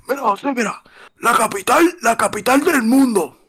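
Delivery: high, mocking imitation of his father gloating; mocking dad. Imita al padre burlándose de EE.UU. (imitando al padre burlón, voz muy aguda)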